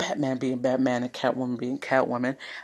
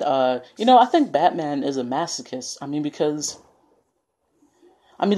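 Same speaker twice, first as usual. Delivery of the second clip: ordinary delivery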